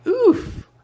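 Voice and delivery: high, loud sharp oof; a pained little oof at someone's loss; mock wince. Reacting to a liquidated trade.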